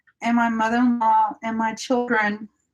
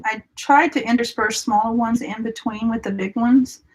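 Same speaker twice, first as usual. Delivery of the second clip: ordinary talk, nothing marked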